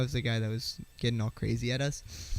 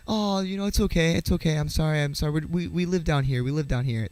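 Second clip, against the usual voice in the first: higher, louder, gushing reassurance; suddenly happy; 5 semitones higher, 5 dB louder than usual. Retold or imitated. Stranger abruptly apologising to dad and kid, really happy. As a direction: imitate a stranger turned suddenly friendly. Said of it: "he gets, like, really happy".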